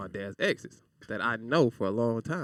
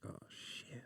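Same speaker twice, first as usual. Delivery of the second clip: whispered oh shit; 23 dB quieter than usual. Retold or imitated. Panic at the door.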